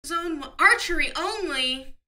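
slow, high and loud outrage; indignant surprise. Real, not acted. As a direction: disbelief at bad news. Reading a disappointing license.

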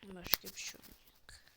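a resigned whisper. Real, not acted. Streamer whispering in resignation to viewers. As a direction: whisper it as if giving up. Giving up on finding a game.

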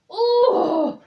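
high, loud straining grunt. A narrator's reading. Douglas hugging something too heavy, grunting with effort. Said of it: "grunted Douglas".

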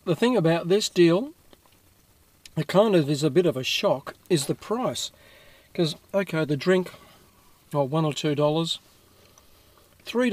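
chewing between words; eating a burger while talking; mouth full. Real, not acted. Seen 0: bites burger at -1s, mouth working while he talks.

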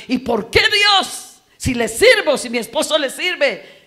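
loud, high, fervent sermon voice; preaching at full force. Acted. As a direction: preach it out to the whole church. Predica a toda voz. (predicación a toda voz, fervorosa)

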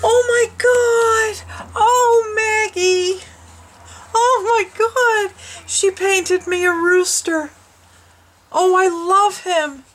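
overjoyed gasp, then high, loud tearful cries; happy crying. Real, not acted. Opening a painted rooster from Maggie, tearful joyful cries. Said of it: "Now I'm crying".

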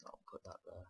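ordinary talk, nothing marked

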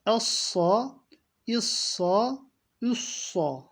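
Essa with a heavy, dark s; Essa, thick sad; thick emphatic s. Shown as a demonstration. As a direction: darken the s in Essa. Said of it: "kalın okunacak".

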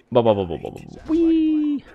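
repeated bu-bu-bu into a high wee; silly babbling ending in a high squeal; playful babble. Pretend. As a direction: burble nonsense, then squeak wee. Idle playful noises while playing.

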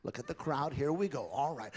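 normal talking voice

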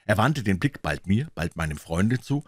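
ordinary delivery

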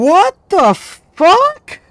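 very high, loud, slow what the fuck; stretched outraged yell. Real, not acted. Gamer furious at the wrong ghost guess, slow outraged yell. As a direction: drag it out in fury. Raging at the missed evidence.

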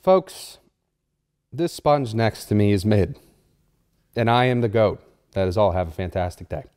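low, cool boast; smug. Acted. Confident closing statement.

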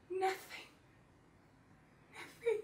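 high-pitched disbelief; incredulous hurt. Acted. Acted hurt at his silence.

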